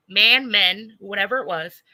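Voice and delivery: ordinary talk, nothing marked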